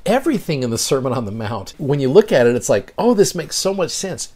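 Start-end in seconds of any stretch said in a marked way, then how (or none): 3.0-4.3 high, lightly amazed aha; a mock lightbulb moment, pitched up; sudden realization. Realization about the Sermon.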